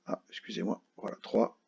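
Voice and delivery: ordinary talk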